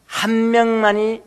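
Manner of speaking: 한 명만이 drawn out slowly; slow emphasis. Real, not acted. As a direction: stretch 한 명만이 to single out the one. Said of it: "slowed for emphasis". (한 명만이를 아주 느리게 힘주어)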